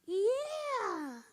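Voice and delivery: high, bright-voiced child; a little girl sure of herself; proud little girl.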